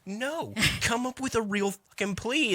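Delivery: high-pitched mock exasperation; lightly voiced judge sending them away; retold impatient ruling; exasperated judge. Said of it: "And he's like".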